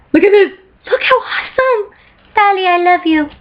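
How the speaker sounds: high, cooing adoration; gushing fangirl. Real, not acted. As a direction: squeal with love over a favourite character. Gushing over Tali picture.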